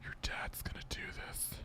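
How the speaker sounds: whispered boast; whispering. Real, not acted. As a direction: whisper it like a secret.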